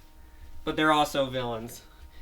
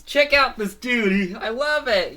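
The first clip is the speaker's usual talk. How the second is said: higher, louder gush; thrilled onlooker; admiring fan; 7 semitones higher, 6 dB louder than usual. Acted. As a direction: rave about the cool suit. Character admires the suit.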